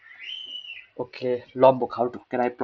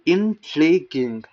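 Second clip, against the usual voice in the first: INTRIGUING very slow and louder, syllable by syllable; careful repetition; 3 semitones higher, 5 dB louder, much slower than usual. Shown as a demonstration. Learner copying the pronunciation clip, slow loud repetition. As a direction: stretch out INTRIGUING, falling at the end. Copies played pronunciation of the word.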